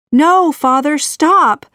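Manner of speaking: high, loud desperate cry. A narrator's reading. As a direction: fling it out, high and loud, to stop him. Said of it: "cried Pocahontas".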